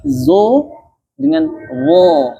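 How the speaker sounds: "Zo" then a high, slow "wo"; teacher contrasting sounds; contrast demo. Shown as a demonstration. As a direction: set "Zo" against "wo". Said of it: "Beda kan?".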